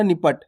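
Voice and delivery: निपट said quickly with a short first i; low, quick निपट with no drawn-out vowel. Shown as a demonstration. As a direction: keep नि brief in निपट. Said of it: "जल्दी से उच्चारण कर देना".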